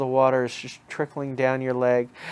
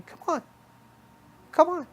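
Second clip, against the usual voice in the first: high, squeaky-pitched bunny voice; little bunny rabbit. Acted. Bunny rabbit beckoning the listener to follow, high little voice. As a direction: pitch it way up as a tiny coaxing rabbit. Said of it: "And he's saying".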